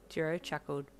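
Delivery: plain reading voice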